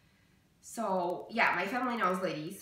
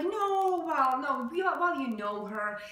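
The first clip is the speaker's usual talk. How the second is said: high, louder mock denial, easing down; imitating evasive mom; 6 semitones higher, 4 dB louder, slower than usual. Mom brushes off her daughter's question about ridicule, imitated evasively. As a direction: mimic mom hedging her way out.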